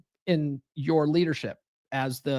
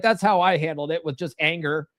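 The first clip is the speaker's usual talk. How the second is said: ordinary talk, nothing marked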